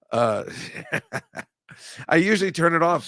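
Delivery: normal talking voice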